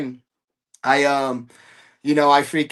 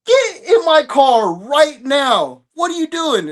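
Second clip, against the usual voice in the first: stern dad yell; 9 semitones higher, 4 dB louder, slower than usual. Retold or imitated. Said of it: "I'm like".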